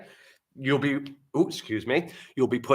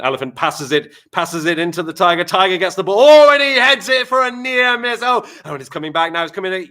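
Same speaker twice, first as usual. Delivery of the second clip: building, louder and higher live play-by-play; sports commentary; 7 semitones higher, 12 dB louder than usual. Acted. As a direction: call it like a thrilling football match.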